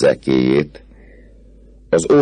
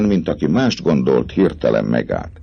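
ordinary delivery, nothing marked

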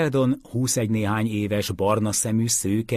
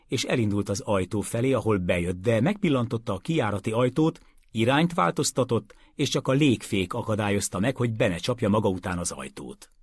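usual speaking voice

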